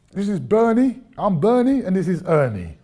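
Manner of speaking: mock-cheery Bernie and Ernie; hammed-up introduction. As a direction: play the pair as a double act. Councillors introducing themselves.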